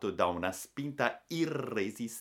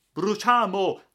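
high, full-force shouts of alarm, stretching out at the end; deafening clamour; 14 dB louder, much slower than usual. A narrator's reading. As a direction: scream the alarm across a burning ship. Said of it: "echeggiò un clamore assordante". (grida d'allarme a squarciagola)

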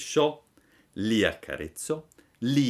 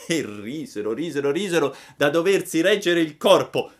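usual speaking voice

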